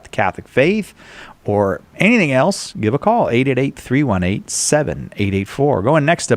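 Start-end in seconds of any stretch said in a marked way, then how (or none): none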